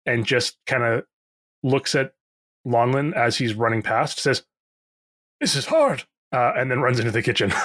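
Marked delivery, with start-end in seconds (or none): 5.4-6.0 high harried complaint. Acted. Pierre complaining while running.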